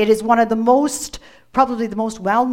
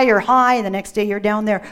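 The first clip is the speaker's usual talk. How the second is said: ordinary talk, nothing marked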